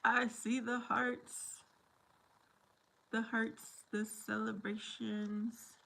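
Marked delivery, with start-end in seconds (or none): none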